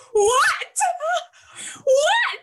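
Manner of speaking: high, loud, mock-aghast "What?"; hammed-up outrage at someone skipping Eurovision; shocked disbelief. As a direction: gasp it out, scandalised at a friend. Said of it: "And I was like".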